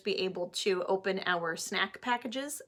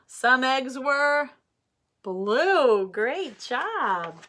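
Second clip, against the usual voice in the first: put-on reading to children; 4 dB louder than usual. Teacher reading Pete the Cat aloud to her class, animated. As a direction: read it like story time for little ones.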